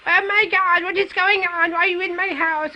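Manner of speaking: high, shrill-pitched imitation of a panicking mother; panicked mother. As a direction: squeal it like a frantic mum bursting in. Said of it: "comes in screaming".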